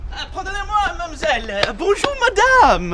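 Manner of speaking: put-on French accent. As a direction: play a hammy Frenchman. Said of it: "[name], what was that accent?".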